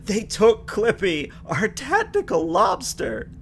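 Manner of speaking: high, slow, melodramatic wail; hammed-up distress. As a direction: overact the loss of the lobster. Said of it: "still hamming it up".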